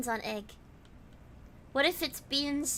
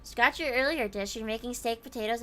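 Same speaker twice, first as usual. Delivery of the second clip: ordinary delivery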